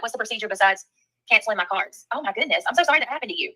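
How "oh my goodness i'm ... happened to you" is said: usual speaking voice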